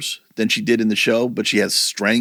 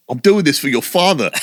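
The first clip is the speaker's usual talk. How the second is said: put-on British accent; 4 semitones higher than usual. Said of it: "there's my best British accent".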